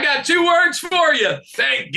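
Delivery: quoted shout. Retold or imitated. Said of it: "my favorite quotes of last week".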